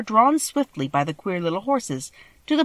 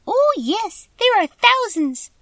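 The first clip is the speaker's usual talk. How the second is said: very high squeaky voice, slow on the second phrase; proud little mouse boasting of her thousands; tiny mouse Queen; slower than usual. Acted. Mouse Queen telling the Scarecrow of her subjects, high and squeaky.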